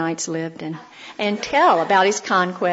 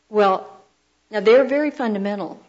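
usual speaking voice

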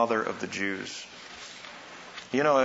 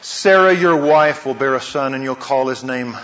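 God's loud proclamation; 3 semitones higher, 11 dB louder than usual. Acted. God's promise voiced loudly.

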